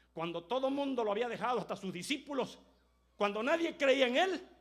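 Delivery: high, loud sermon climax; fiery preaching. Acted. Preacher crying out about the dying Christ, high and loud. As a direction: build it to a cry.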